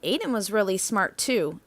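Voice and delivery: ordinary delivery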